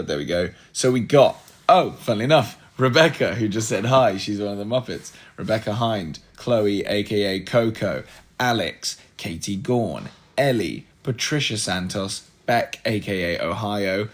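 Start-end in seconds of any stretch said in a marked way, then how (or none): none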